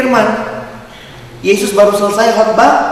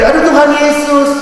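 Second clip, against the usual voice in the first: higher, put-on yelp of Tuhan Yesus; mock pious cry; 7 semitones higher than usual. Friend with finger caught in a door: mock pious yelp. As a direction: parody a friend's dramatic cry. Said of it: "Ada satu temen gitu".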